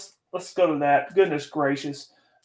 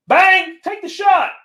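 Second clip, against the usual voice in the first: real excited fan yell; 13 semitones higher, 4 dB louder, slower than usual. Real, not acted. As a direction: shoot way up in pitch, yelling at the player. Yelling at the game on screen.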